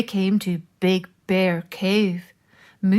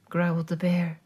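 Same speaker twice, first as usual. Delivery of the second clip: ordinary delivery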